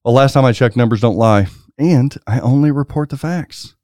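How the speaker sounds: normal talking voice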